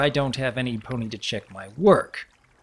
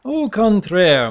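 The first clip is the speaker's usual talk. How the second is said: louder, slow, grandly drawn out; theatrical flourish; 7 semitones higher, 7 dB louder, slower than usual. Acted. Gabardine playfully contradicting Ripple with a grand flourish. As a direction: announce it like a showman.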